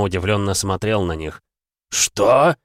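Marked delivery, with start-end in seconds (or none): 1.9-2.6 ошеломлённо. Acted. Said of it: "только и смог пробормотать он".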